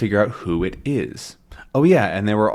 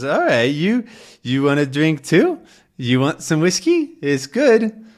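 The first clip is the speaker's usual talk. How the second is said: raised, climbing voice for a tipsy dog; 7 semitones higher, 3 dB louder than usual. Acted. Snowy offering Tintin some whiskey, tipsy. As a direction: offer a drink as a tipsy pup. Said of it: "Snowy is very, ooh, tipsy".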